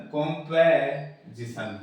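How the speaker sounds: कॉम्पोजिशन slowly split after "kompe"; slow teaching take of कॉम्पोजिशन with "pe"; weak form. Shown as a demonstration. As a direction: stretch "pe" in कॉम्पोजिशन to show the vowel. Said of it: "की जगह ये बोलेगा".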